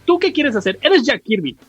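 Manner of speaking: usual speaking voice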